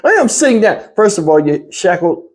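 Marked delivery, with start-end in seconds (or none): 0.0-0.8 imitating a grown man sounding like a five-year-old, higher; mock childish protest, pitched up; childlike voice. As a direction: whine it like a sulking small boy. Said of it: "That's how he sounded".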